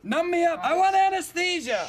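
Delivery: high, strained demand; pretend labour agony. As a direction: beg through mock contractions.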